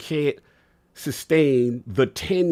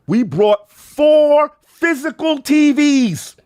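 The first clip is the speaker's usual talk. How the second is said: much higher and louder, impassioned; emotional; 10 semitones higher, 7 dB louder than usual. Real, not acted. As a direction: shout your love for the game. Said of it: "'cause I'm getting emotional".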